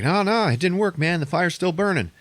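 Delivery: hammed-up dismay that it failed; Wahlberg panicking. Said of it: "Mark Wahlberg is like".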